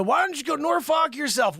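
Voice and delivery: ordinary delivery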